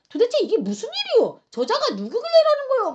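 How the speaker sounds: high, agitated bystander's question; puzzled questioning. Acted. Townspeople asking about the uproar, high baffled demand. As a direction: demand to know what the commotion is. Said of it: "묻는 사람까지".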